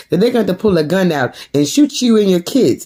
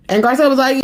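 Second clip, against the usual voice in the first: ordinary talk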